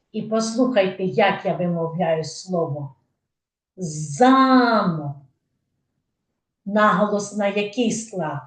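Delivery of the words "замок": "замок" with long, stressed за; stress on first syllable. Shown as a demonstration. Teacher asking where stress falls, за in "замок" stressed. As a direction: stretch за in "замок", мок light. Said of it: "Так, на перший".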